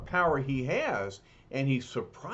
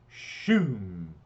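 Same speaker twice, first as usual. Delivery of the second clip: whoosh sound effect; 6 semitones lower than usual. Acted. Surfboard returns sound.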